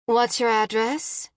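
plain reading voice

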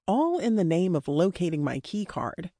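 plain reading voice

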